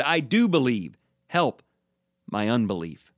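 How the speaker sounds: normal talking voice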